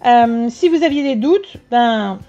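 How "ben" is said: normal talking voice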